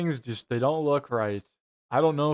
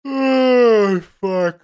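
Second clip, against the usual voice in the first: shocked shout; 9 semitones higher, 8 dB louder than usual. Real, not acted. Shocked outburst at the scene.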